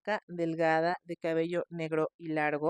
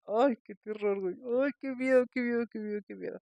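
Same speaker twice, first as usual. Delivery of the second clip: mock fright; 3 semitones higher, faster than usual. Host reacts scared to story.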